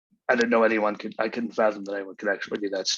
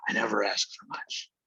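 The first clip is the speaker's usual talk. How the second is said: a soft, breathy whisper to self; whisper, half to yourself; whispered aside; 5 dB quieter than usual. Real, not acted. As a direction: say it almost under the breath.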